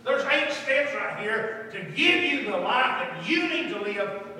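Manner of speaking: loud, high, slowed exhortation; emphatic preaching. Real, not acted. Preacher offering congregation eight steps, emphatic. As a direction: drive the point home from the pulpit.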